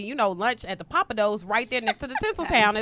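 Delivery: fast, offhand list of details; fast, breezy liar's alibi; rattled-off alibi. Retold or imitated. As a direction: reel off the cover story quickly.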